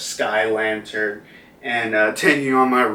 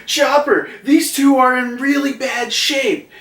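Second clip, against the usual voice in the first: much higher, worried put-on voice; worried Usopp; 13 semitones higher than usual. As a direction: call for the doctor in a much higher, anxious voice. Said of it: "Usopp's like".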